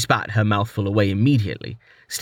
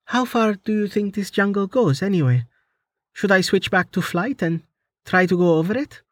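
put-on higher, lighter young woman's voice; teasing young woman, higher pitched; Amelia's voice; 9 semitones higher than usual. A narrator's reading. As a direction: voice the heroine a step above your own.

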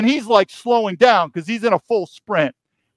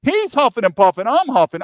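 normal talking voice

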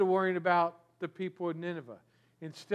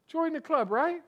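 ordinary delivery

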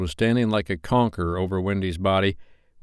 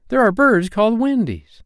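put-on high, eager boy's voice; excited announcement; 13 semitones higher, 5 dB louder than usual. A narrator's reading. Slightly remembering for the boys, high excited announcement. Boy announces discovery in story.

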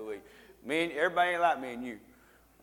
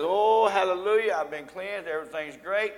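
mock rejoicing; 5 semitones higher, 7 dB louder than usual. Acting the nine cleansed lepers.

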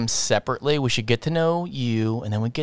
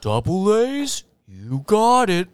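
slow, stretched toy recorder voice; slowed Talk Boy voice; 5 semitones higher, much slower than usual. Pretend. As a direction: drag the words out like a slowed tape. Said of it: "is what you should have said".

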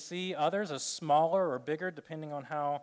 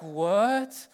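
mock disbelief; 5 semitones higher, 9 dB louder than usual. Silver medalist incredulous.

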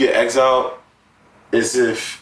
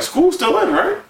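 incredulous. Real, not acted. Incredulous high voice.

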